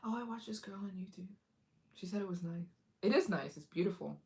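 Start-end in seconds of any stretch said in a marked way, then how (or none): 0.0-2.6 whispered, softer confession; admitting the YouTube reason in a hush; sheepish whisper. Retold or imitated. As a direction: whisper it like a guilty secret. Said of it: "They'd be like".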